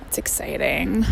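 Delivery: whispered aside; a soft unvoiced whisper. Real, not acted. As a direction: breathe it out as a secret. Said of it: "My birthday is next week".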